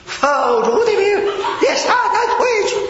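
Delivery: high, slow, held-back shouted command; a mock shout that pulls its punch at the end; half-hearted rebuke. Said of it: "我里头有点保留".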